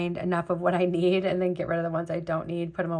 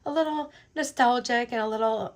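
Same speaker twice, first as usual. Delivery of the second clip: real catch of emotion while apologizing; a little nostalgic and choking up; choked up; 4 semitones higher, 3 dB louder than usual. Real, not acted. Apologizing to viewers for getting nostalgic, choked up. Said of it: "I'm getting choked up".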